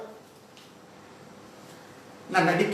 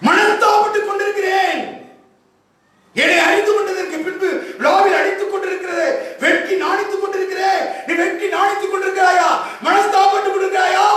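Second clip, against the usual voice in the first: fervent preaching; 8 semitones higher than usual. Acted. Preacher urging the congregation about God's longing, fervent preaching. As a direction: press each phrase on the congregation.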